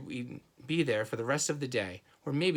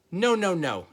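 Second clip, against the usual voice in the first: put-on loud, higher childish holler; 6 semitones higher, 8 dB louder than usual. A narrator's reading. Toddler refusing shoes in the store, loud holler. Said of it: "he hollered".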